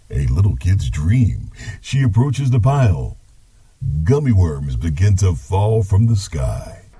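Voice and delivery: story narration. Acted. Grandpa narrates.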